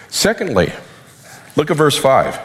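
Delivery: tired, hoarse preaching voice. Real, not acted. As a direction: voice wearing out from preaching. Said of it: "I'm already losing my voice".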